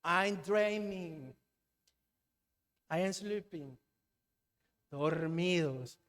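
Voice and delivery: slow, soft, drawn-out words; mock dreaminess. Preacher mimicking a sleeping congregation, dreamily slow. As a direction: drift along softly as if half asleep.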